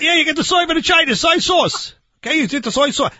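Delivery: high, hurried, worked-up shout; exasperated insistence. Acted. Barbecue man insisting soy means sauce, exasperated.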